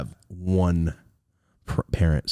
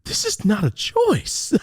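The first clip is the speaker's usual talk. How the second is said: mock dad; 13 semitones higher, much slower than usual. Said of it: "I could see him like that".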